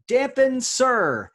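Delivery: high, loud and slow, stretching each word; drawn-out announcement. Acted. Quiz host unveiling the anagram clue to players, grand and drawn out.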